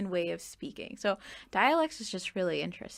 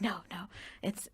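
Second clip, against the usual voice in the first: whisper; 8 dB quieter than usual. Retold or imitated. Mom insisting on a spice.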